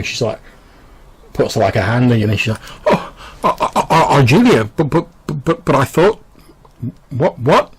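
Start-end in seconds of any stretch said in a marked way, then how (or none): 2.9-7.7 higher, louder flustered stammer; stammering shock. Acted. Madam sees the dead woman alive, stammering in shock. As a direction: trip over every word in confusion.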